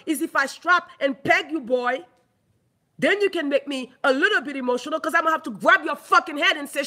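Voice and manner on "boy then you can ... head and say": ordinary delivery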